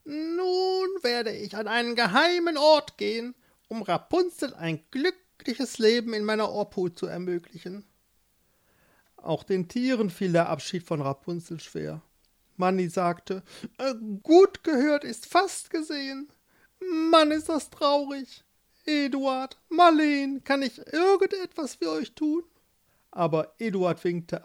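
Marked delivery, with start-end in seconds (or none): 0.0-7.8 put-on high, sly old witch. Witch voiced in story. 13.8-22.4 high, sad little mole; the mole, upset and eager to help. Acted. Manni the mole voiced.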